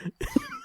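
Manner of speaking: a high squealing laugh. Real, not acted. Big man player laughing at the neck twist, squealing.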